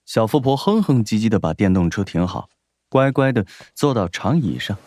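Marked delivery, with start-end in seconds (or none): none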